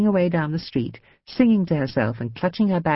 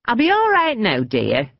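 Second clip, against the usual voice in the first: put-on old lady; 7 semitones higher, 3 dB louder than usual. A narrator's reading. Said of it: "the old woman told her".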